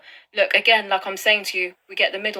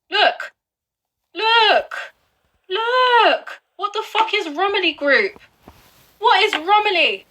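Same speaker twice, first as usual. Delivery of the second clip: alarmed outburst; 8 semitones higher, slower than usual. Real, not acted. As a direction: jolt up in shocked disbelief. Shocked at a company name.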